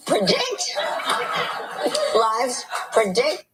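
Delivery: correcting herself through laughter; laughing. Real, not acted. Corrects slip while laughing.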